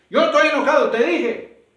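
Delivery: hammed-up furious shout; furious denial. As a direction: blow up while insisting you're calm. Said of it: "por qué me hablas con tanto gozo en tu voz".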